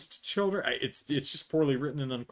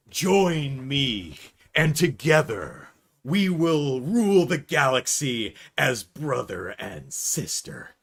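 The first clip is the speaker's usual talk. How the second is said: slow, dramatic Vader-style invitation; mock villain offer; 4 dB louder, slower than usual. Brother tempting Vanessa, slow mock-Vader grandeur. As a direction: lure her like a space-opera villain. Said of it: "he's like".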